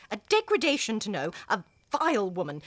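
normal talking voice

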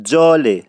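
جاله said loud with a ج that has a touch of ژ; Isfahani j for zh. Shown as a demonstration. Teacher showing how Isfahanis say ژاله. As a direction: say جاله, not ژاله, with a buzzing ج.

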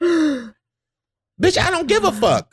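high-pitched exasperated retort; hammed-up, high indignant outburst; retold exasperation. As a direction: squeal the brush-off in comic exasperation. Retells exasperated reply to crier.

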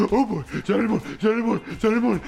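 fast, giggly repeated chant; excited little boy. Acted. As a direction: rattle it off like a thrilled kid.

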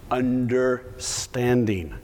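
slow, drawn-out Understanding; Understanding spoken slowly as the one word that matters; emphatic single word. Real, not acted. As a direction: lean hard on Understanding, stretching every syllable. Key word of the message.

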